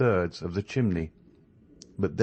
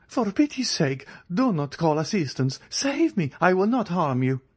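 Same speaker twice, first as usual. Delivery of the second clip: much higher, frantic begging; desperate plea; 10 semitones higher than usual. A narrator's reading. Andrea begs the ladies not to call help, frantic and high. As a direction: plead so they won't ring for help. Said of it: "he cried, pale and bewildered".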